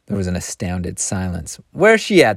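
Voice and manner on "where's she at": high, piercing demand; piercing cry. A narrator's reading. Youngest woman demanding the girl, piercing cry. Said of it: "cried in a piercing voice".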